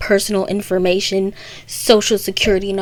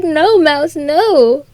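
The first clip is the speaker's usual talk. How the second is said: higher, louder, drawn-out call; mock pleading cry; 6 semitones higher, 5 dB louder, slower than usual. Alice calling after the fleeing mouse, mock pleading. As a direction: call out in mock alarm to stop someone.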